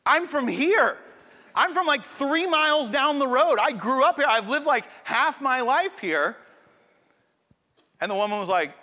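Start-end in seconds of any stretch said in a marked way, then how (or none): 0.1-6.4 higher, louder indignant retort; indignant protest. Retold or imitated. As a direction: protest loudly that you really are a local, pitch up. Wife protesting she is local.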